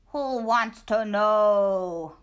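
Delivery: slow, loud growling stranger. A narrator's reading. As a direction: growl it from the dark. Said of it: "growled a voice from inside".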